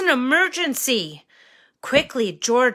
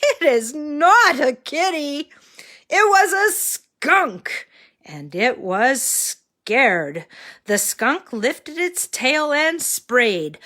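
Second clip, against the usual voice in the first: put-on reading to a toddler; 3 semitones higher than usual. A narrator's reading. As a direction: read it like to a small child. Reads story to children.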